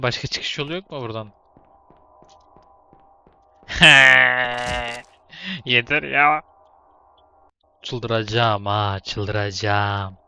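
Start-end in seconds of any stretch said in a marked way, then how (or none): 3.7-5.0 exasperated laugh. Real, not acted. Laughs at own failure. 7.8-10.2 slow drawn-out groan. Real, not acted. Repeats in frustration.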